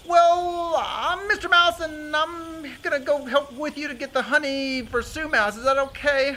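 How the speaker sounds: high, stretched-out timid mouse; squeaky mouse introducing himself; nervous Mister Mouse. Acted.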